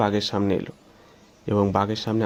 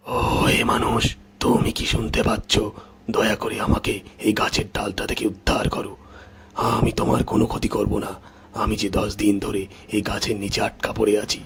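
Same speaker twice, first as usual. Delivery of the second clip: pleading tiger; 2 semitones lower than usual. Acted. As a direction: beg for rescue as a trapped beast. Trapped tiger begs the farmer.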